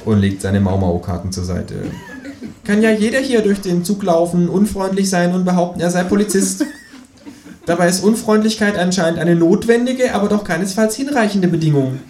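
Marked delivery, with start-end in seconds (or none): 2.6-12.0 much higher cheeky kangaroo voice. Acted. Kangaroo sasses the policeman, high cheeky kangaroo voice. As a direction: sass the officer as the kangaroo.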